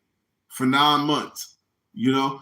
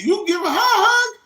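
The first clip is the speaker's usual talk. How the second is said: high, louder mocking yell with a laugh; incredulous yell; 5 dB louder than usual. Comic mocking the host's hug order, high laughing yell. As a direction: throw the host's words back.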